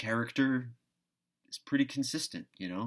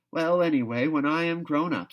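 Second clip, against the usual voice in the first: raised, firm and loud declaration; decidedly; 7 semitones higher, 8 dB louder than usual. A narrator's reading. Anne declares to Marilla what she'll do when grown up, firmly. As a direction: announce it like a settled vow. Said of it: "said Anne decidedly".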